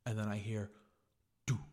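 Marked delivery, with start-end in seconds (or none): 1.5-1.6 single short "do" thud; one isolated step; imitated footstep. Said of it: "dude, the footsteps".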